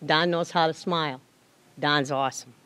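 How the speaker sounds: usual speaking voice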